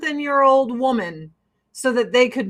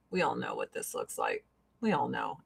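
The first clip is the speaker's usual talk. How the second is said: hushed aside; 11 semitones lower, 12 dB quieter than usual. Real, not acted. To viewers about the gross mask, hushed. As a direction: drop to a much softer, lower aside.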